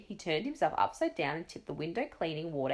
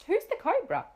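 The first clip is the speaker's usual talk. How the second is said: surprised; 11 semitones higher, 4 dB louder than usual. Acted. Crowd asks in surprise.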